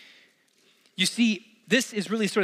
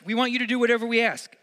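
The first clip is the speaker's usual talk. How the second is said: higher and louder, a brash demand; bold demand; 5 semitones higher, 4 dB louder, faster than usual. Retold or imitated. James and John boldly demanding of Jesus, brash and pushy. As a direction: push "whatever" like a cheeky ultimatum. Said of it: "It's kind of bold".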